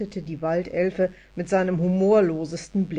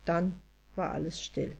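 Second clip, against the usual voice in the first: plain reading voice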